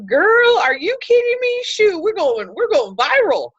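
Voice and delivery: high, loud squeal of delight; gushing girlfriend voice, way up high; excited friend. Retold or imitated. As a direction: squeal it like your friend going viral.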